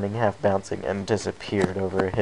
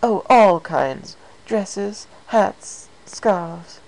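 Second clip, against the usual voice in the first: refined, higher lady voice; a hint of an elegant fashionista; lightly voiced Rarity; 8 semitones higher, 4 dB louder, slower than usual.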